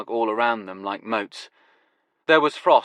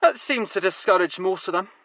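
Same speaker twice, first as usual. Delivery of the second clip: put-on Glasgow accent; 9 semitones higher than usual. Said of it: "his Glasgow accent now going full throttle".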